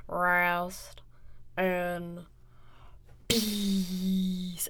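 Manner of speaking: low, slow deep-voiced imitation of a menacing wrestler; imitated wrestler line. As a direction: drop low and drag it out ominously. Quoting a wrestler's promo.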